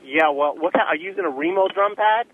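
normal talking voice

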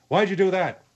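normal talking voice

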